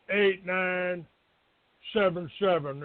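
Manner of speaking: ordinary talk, nothing marked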